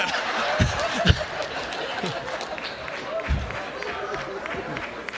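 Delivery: soft airy chuckle; quiet breathy laugh. Real, not acted. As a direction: laugh softly to yourself. Laughs at his own joke.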